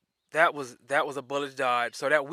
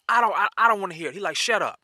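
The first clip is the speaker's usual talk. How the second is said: fast, higher and louder, cutting him off; angry shout; 6 semitones higher, 7 dB louder, faster than usual. Retold or imitated. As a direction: bark at him to stop talking. Officer cutting him off angrily.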